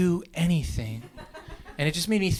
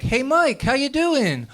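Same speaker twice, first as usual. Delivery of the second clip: high, chummy hello; cheery greeting; 10 semitones higher, slower than usual. Acted. Comic greeting his buddy Mike, cheery. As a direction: greet a buddy with a breezy lift.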